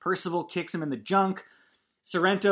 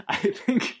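laughing; 10 semitones higher, 6 dB louder than usual. Real, not acted. Laughing fit mid-sentence.

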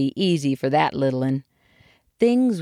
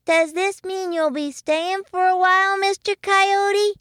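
very high, small hopeful voice; little kid voice. A narrator's reading. Young muskrat asking the coyote to stay, high little voice. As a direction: ask it as a little one, stretching the end. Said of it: "a little voice belonging to Jimmy Bobby".

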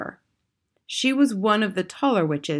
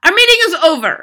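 high, loud commanding announcement; Grand High Witch; 11 semitones higher, 11 dB louder, much faster than usual. A narrator's reading. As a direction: proclaim it as the witches' imperious leader. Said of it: "announced the Grand High Witch".

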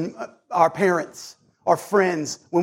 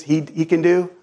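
ordinary talk, nothing marked

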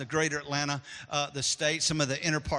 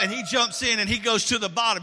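excited shout; 5 semitones higher, 6 dB louder than usual. Acted. Kid plunges into the pool.

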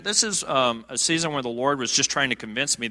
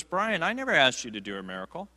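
softer, calm reassurance; quiet gentle Lord; 7 dB quieter than usual. Retold or imitated. The Lord quieting the anxious preacher, soft reassurance. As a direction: settle him down gently. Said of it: "he quiets me".

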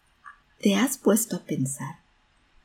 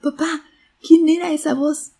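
put-on high, loud child's question; child asking papa; 10 semitones higher, 7 dB louder than usual. A narrator's reading. Boy asks his father about the voice, high child voice. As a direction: ask it pitched well up, like a small boy. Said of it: "El niño vio a su papá y le preguntó".

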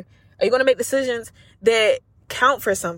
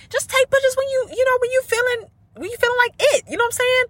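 high-pitched. Real, not acted. High squeaky emphatic advice.

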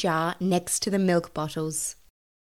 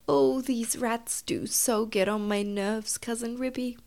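exasperated; 4 semitones higher, 5 dB quieter than usual. Acted. Tabitha sick of rats, confiding in her cousin, weary. Weary mother cat complains.

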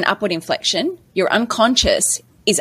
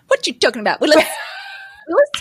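higher-pitched light imitation of an old TV line; playful mock catchphrase; sitcom catchphrase; 8 semitones higher, much faster than usual. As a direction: toss out a catchphrase with a laugh. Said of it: "do another old reference".

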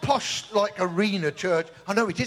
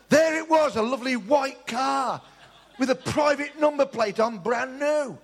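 high, excited exclaim; 7 semitones higher than usual. A narrator's reading. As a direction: marvel at the shiny new car. Narrates the dream car appearing.

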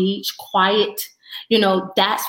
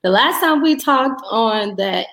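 ordinary talk, nothing marked

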